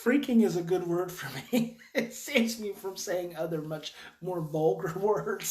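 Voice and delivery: chuckling self-mockery; amused. Real, not acted. Amused at own word choice.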